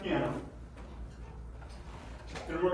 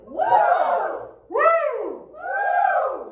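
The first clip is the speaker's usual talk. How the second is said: high, loud falsetto whoops; falsetto woo; 6 dB louder, much slower than usual. Shown as a demonstration. Choir teacher modelling falsetto. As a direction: send it way up. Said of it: "Say falsetto".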